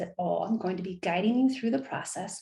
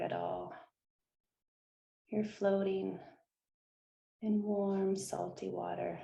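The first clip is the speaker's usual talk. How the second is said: guided meditation; 8 dB quieter than usual. Acted. Host guiding listeners through visualization, soft meditation voice. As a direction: gently lull listeners into stillness, hushed and unhurried.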